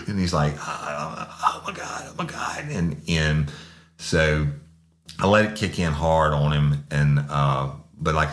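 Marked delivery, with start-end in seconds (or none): 0.6-2.6 panicked cry. Retold or imitated. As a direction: gasp it out as the burning starts. Suspect as spray kicks in.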